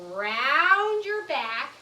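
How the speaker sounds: slow, swelling ROUND; drawn-out instruction. Shown as a demonstration. Teacher coaxes children to round their backs, drawn-out. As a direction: stretch out ROUND slowly.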